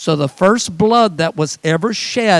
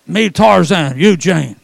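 mock caveman; 5 dB louder than usual. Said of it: "Where's the gentleness there?".

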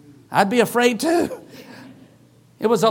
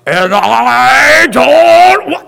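man's bass voice; 3 semitones higher, 12 dB louder, much slower than usual. Retold or imitated. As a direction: turn her into a snarling man. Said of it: "cursing at me in a man's bass voice".

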